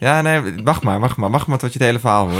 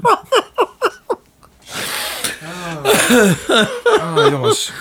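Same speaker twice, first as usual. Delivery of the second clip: high, wheezing laughter, tears; crying with laughter; slower than usual. Real, not acted. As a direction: laugh until tears come. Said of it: "De tranen lopen". (huilend van het lachen)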